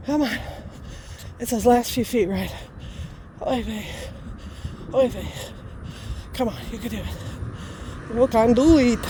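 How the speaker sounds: winded, sighing between short phrases; out of breath. Real, not acted. Vlogger coaxing herself up the last few feet, real panting. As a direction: urge yourself uphill with little breath left. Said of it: "Sorry, I can't talk as well".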